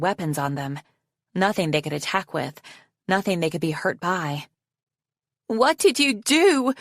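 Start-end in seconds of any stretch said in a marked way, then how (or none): 5.5-6.7 high, slow accusing yell. A narrator's reading. Survivor confronting Arctic over the fallen guards, yelling. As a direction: yell it aghast, drawing it out. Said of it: "one of them yelled".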